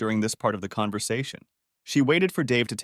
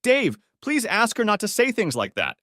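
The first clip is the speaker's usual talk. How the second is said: high-pitched, distressed plea; begging; 11 semitones higher than usual. A narrator's reading. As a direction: plead urgently, voice pitched high. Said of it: "Linda begged".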